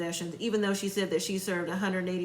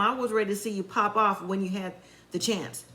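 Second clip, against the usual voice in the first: ordinary talk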